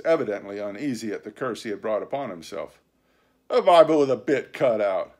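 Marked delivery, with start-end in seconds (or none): 3.5-5.1 loud, mocking pirate retort; derisive. A narrator's reading. Silver answering Dick about the Bible, derisive. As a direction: scoff at the very idea. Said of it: "returned Silver derisively".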